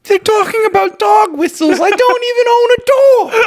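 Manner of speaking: very high, wailing protest; hammed-up childish indignant whine; whiny little boy. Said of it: "doing a whiny little".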